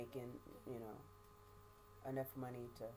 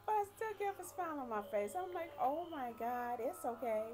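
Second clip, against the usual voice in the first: smiling through it; 12 semitones higher than usual. Real, not acted. Recalling how she reassured her crying family. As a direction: force a smile into the words.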